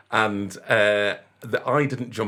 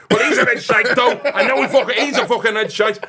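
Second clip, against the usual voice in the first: high, louder mock rant through laughter; imitating the fan, laughing; 11 semitones higher, 7 dB louder than usual. Fan insisting he's still a red, laughing mock rant. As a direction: laugh while ranting as the fan. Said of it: "And he went".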